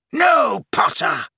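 loud, high cry falling on the name; screamed. A narrator's reading. Snape blocking Harry's spell, screamed. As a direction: scream it to stop him. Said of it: "screamed Snape".

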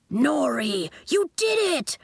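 high, jubilant cry; excited cry. A narrator's reading. Coach celebrates Nori turning into a flamingo, crying out. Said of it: "Coach cried".

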